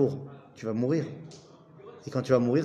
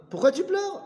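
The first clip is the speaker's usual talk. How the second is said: higher, gently puzzled question as the rabbi; Rabbi Yochanan asking; 13 semitones higher than usual. Retold or imitated. Teacher voicing Rabbi Yochanan asking Elazar why he weeps, higher. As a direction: voice the rabbi asking why his friend cries. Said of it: "c'est Rabbi Yochanan qui dit à Rabbi Elazar".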